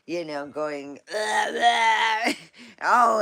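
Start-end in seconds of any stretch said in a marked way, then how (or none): 1.1-2.3 loud, high imitated gag; mock gagging. Thief gets poo in mouth. 2.6-3.2 laughing. Real, not acted. Laughing at the thief story.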